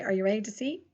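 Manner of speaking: normal talking voice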